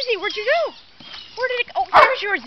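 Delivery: ordinary delivery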